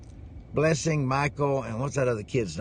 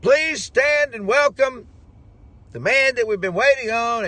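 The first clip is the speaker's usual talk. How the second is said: announcer; 9 semitones higher, 5 dB louder, slower than usual. Retold or imitated. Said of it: "He says".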